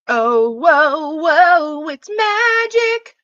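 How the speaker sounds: loud, high, slow whoa of delight; excited amazement. Real, not acted. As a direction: marvel out loud at the cat. Cat does a trick.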